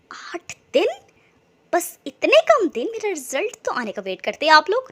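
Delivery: can't believe it's so soon; surprised. A narrator's reading. Rubab hears the wedding is in eight days, surprised. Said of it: "रुबाब हैरान हुई".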